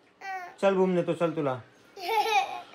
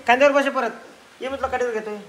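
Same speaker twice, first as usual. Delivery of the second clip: ordinary talk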